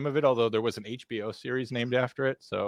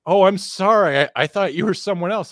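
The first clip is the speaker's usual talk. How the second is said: put-on apologetic drunk guy; 8 semitones higher, 8 dB louder than usual. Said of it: "and the guy's like".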